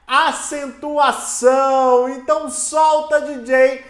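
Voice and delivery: loud, rallying teacher call; hyped kickoff. Real, not acted. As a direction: fire up the class like a show opener. Animated kickoff of the lesson. (chamada empolgada de professor)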